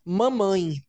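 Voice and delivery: "Mamãe." with a clear falling ending; careful intonation. Shown as a demonstration. Teacher models correct word for parents, clear. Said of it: "Olha a entonação que eu estou usando".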